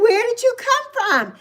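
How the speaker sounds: high-pitched, astonished question; surprised at kitten. Retold or imitated. Woman to a stray kitten at her door, surprised. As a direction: act out puzzled delight at a small newcomer. Greeting the kitten that just appeared.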